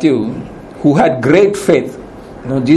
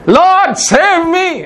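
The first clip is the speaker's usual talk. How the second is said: much higher, urgent cry for rescue; pleading cry, pitched way up; desperate plea; 11 semitones higher, much slower than usual. Pretend. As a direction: call out as if drowning. Woman cries out to Jesus.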